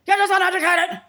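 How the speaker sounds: high, loud scornful jeer; mocking shout. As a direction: throw the insult back as a yell. Mocking what he was told.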